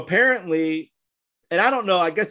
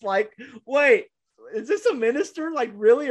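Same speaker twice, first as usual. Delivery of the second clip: high, disbelieving; can't believe it; incredulous; 7 semitones higher than usual. Retold or imitated. Incredulous at the tattooed minister.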